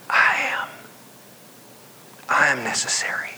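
hushed whisper of Jesus; tender whispered reply. Acted. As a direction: breathe it gently, barely voiced. Jesus voiced whispering to Martha.

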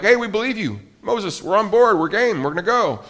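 high, bright-eyed eager crowd voice; eager agreement. Retold or imitated. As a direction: jump in like a crowd that's all in. People eagerly agree with Moses.

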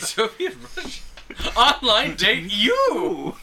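put-on high, loud, slow Russian accent. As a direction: play a heavy Russian accent, slowly. Yakov-style Russian joke.

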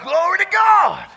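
high, loud shout of praise; exultant praise. Real, not acted. Preacher praising God before the congregation, exultant shout. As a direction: shout it out in triumph.

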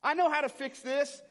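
high, loud know-it-all declaration; barging in. Acted. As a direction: burst in loudly, overconfident. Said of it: "barge his way in and say".

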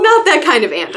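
loud, cross retort; indignant correction; crossly. A narrator's reading. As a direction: snap it back, irritated. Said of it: "I said crossly".

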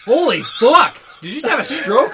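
loud, high astonished outburst through laughter; laughing disbelief. Real, not acted. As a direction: react in amused shock to a friend's blunder. Mocks friend's stumble over a name.

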